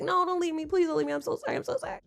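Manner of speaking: high, small begging voice; hammed-up soft pleading, fading out; mock pleading. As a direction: imitate a clingy, apologetic girlfriend. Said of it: "she was gonna be like".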